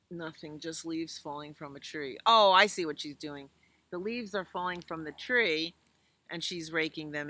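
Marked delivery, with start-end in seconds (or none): none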